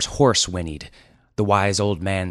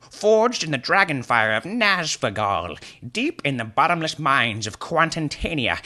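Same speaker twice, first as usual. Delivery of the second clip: high, loud, grandiose wizard ranting; wizard's tirade; 8 semitones higher, 3 dB louder than usual. Acted. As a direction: threaten with pompous fantasy grandeur. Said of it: "His tirade was brusquely interrupted".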